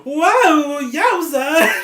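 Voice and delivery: laughing mock wow yowza; amused wow. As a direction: gasp it out like he's thrilled. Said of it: "He's just like".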